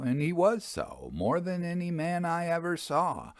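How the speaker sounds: plain reading voice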